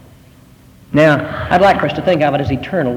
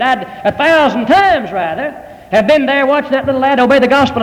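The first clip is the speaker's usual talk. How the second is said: higher, emphatic pulpit testimony; preaching; 7 semitones higher than usual. Acted. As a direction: testify with conviction. Preaching conclusion.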